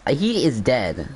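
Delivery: ordinary talk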